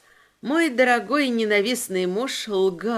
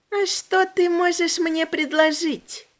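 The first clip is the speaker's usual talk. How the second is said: put-on creepy demon girl; 7 semitones higher than usual. A narrator's reading. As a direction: savor the words like a hungry child demon.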